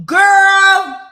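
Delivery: sudden loud joyful shout at the phone; excited shout. Real, not acted. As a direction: call out in pure delight. Excited shout at incoming money.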